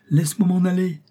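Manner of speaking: put-on a scared little boy pleading to escape; frightened child crying out. A narrator's reading. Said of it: "s'écria-t-il".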